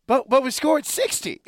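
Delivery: mock-outraged fan voice pointing at the score; loud, high "but we scored sixty!"; incredulous inner fan. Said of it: "in my mind, I'm like".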